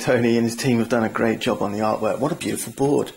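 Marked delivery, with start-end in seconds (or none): none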